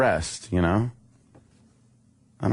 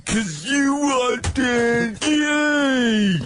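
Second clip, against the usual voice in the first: crying shout; much slower than usual. Acted. Clip shouted crying rant.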